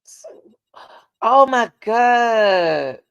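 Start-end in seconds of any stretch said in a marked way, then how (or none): 1.2-3.0 loud, drawn-out, high exclamation; stunned cry, slow and high; shocked. Real, not acted. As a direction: stretch it out loud in real disbelief. Shocked at news about the girl.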